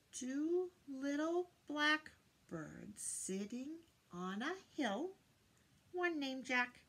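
sing-song rhyme, slow opening line; nursery rhyme recitation. Shown as a demonstration. Teacher reciting the blackbird rhyme to children. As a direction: recite it playfully for the little ones.

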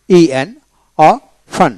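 ordinary talk, nothing marked

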